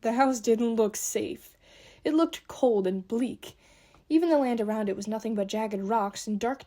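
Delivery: plain reading voice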